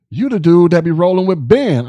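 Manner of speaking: calling out loudly, high and eager; a soldier spotting him, loud and thrilled; loud excited recognition. Retold or imitated. Soldiers on his new base recognizing his name, loud excited call-out.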